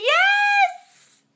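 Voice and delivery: very high, squealed yes; excited yes. Real, not acted. Host squealing yes to a Toronto invitation, very high. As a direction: cheer at the invitation.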